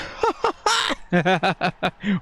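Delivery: real laugh of disbelief; delighted laugh. Real, not acted. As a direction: burst out laughing at the luck. Laughing after goal.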